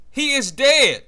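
loud, high-pitched call; excited exclamation. Real, not acted. Gamer reacting to a kill in the match, excited. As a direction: burst out loudly with amazement.

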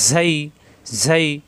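ঝাই with air whistling out on a long buzz; drawn-out buzzing start on ঝাই; whistling sibilant. Shown as a demonstration. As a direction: let air escape through the first sound of ঝাই. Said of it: "বাতাস বের হবে".

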